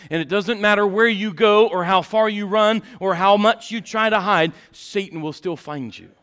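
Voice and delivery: loud, fast, driving sermon delivery; full-force preaching. Real, not acted. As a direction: preach it hard and loud without letting up.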